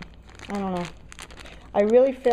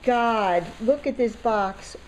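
ordinary talk

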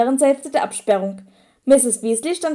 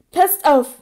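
knapp und bestimmt, etwas höher; kurz angebunden; 4 semitones higher, 3 dB louder than usual. A narrator's reading. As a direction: kurz abschneiden. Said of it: "sagte er kurz angebunden". (Harry schneidet den Zwillingen kurz angebunden das Wort ab)

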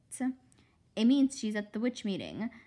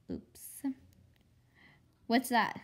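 ordinary delivery

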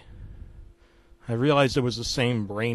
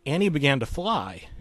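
ordinary delivery

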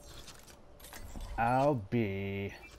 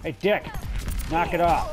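yelling; 4 semitones higher, 5 dB louder than usual. Real, not acted. Yelling at teammate.

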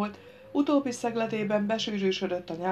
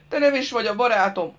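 put-on loud, higher childish lament; wailing protest; 5 semitones higher, 8 dB louder than usual. A narrator's reading. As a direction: wail like a hurt simpleton. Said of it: "óvégatta".

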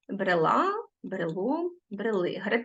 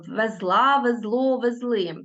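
везла, везло, везли stressed on final syllable; stress on endings. Shown as a demonstration. Teacher shows pupils ending stress, stressing last syllable of везла, везло, везли. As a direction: hit ЗЛА, ЗЛО, ЗЛИ in везла, везло, везли. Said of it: "наголос падає на закінчення".

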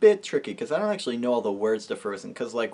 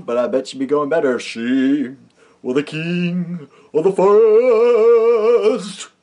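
slow, hammed-up growly lion; Bert Lahr imitation; 6 semitones higher, 7 dB louder, much slower than usual. Singer doing a Bert Lahr lion for camera, hammed up. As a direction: stretch the vowels like a vaudeville lion. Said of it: "That's my Bert Lahr imitation".

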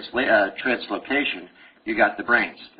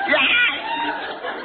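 squeaky mock reply.

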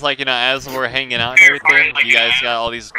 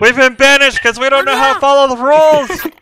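shouting; 13 semitones higher, 6 dB louder than usual. Pretend. Yelling rule-breakers to be banished.

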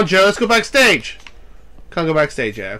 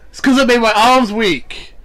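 ordinary talk, nothing marked

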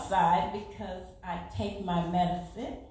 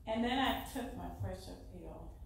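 ordinary talk, nothing marked

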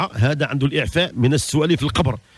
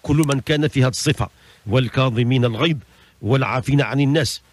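normal talking voice

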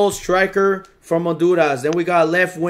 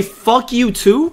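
incredulous repeat; 5 semitones higher, slower than usual. Real, not acted. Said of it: "Did you just say".

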